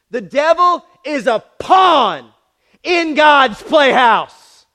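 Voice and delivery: loud, slow, drawn-out preaching shout. Real, not acted. Preacher shouting to the congregation, loud and slow. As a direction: hammer the point home from the pulpit.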